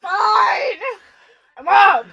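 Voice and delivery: high, loud retold outburst; exasperated shout. Roommate woken by the yelling, exasperated shout. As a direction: shout it as if dragged out of sleep.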